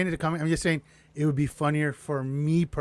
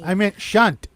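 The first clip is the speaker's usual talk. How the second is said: mock Mexican accent; 4 semitones higher, 6 dB louder, much slower than usual. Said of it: "I have a Mexican accent".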